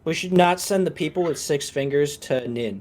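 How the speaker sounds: ordinary delivery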